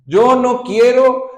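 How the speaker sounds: high, loud, slow protest; a high, loud, slow whine of refusal; stubborn refusal. Acted. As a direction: dig in your heels and cry it out. Stubborn believer's prayer acted.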